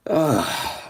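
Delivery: a loud, high, strained groan; loud groan fighting back tears; pained groan. Real, not acted. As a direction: let out a real groan of grief. Choking up on stream.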